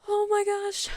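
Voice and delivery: high, soft squeal of delight; thrilled squeal. Real, not acted. Vlogger squealing softly to fans over finally seeing Shadow. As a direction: let the joy slip out up high.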